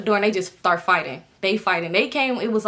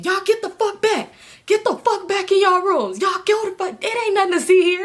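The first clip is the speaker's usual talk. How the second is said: high, louder mock yell at onlookers; imitating a yelling gunman; 11 semitones higher, 3 dB louder than usual. The gunman yells at neighbors to go back inside, mock shout. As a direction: bark them back into their rooms.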